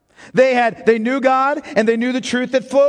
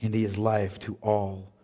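much softer and lower, hushed; quiet reverence; 9 semitones lower, 5 dB quieter than usual. Acted. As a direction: speak it like a gentle assurance. Quiet closing on Christ.

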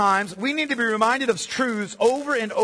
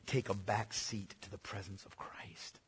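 hushed whisper; 13 semitones lower, 19 dB quieter than usual. Real, not acted. Preacher drops to hushed awe.

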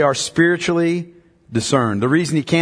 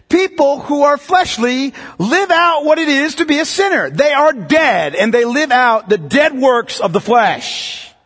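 impassioned preaching; 7 semitones higher than usual. Acted. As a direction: thunder it from the pulpit, slowing on "They are dead". Preaching at full force.

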